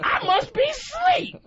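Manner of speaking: slow, very high comic disbelief; mock wino. Wino baffled by Freddy's gloves, mock disbelief. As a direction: stretch it out in high-pitched wonder. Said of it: "it's always a wino".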